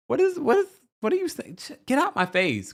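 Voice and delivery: high, baffled disbelief; can't believe what she's hearing, pitch up high; incredulous. Retold or imitated. Baffled viewer reacting to Monique, high and incredulous. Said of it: "I was like".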